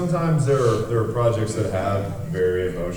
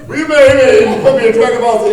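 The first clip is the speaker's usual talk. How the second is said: excited cheer; 14 semitones higher, 11 dB louder than usual. Pretend. Joking cheer in the booth.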